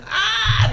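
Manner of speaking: very high mock scream; drawn-out, high squeal of nerves; freaked-out squeal. Food runner inwardly panicking at the table, freaked-out squeal. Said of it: "like, so freaked out".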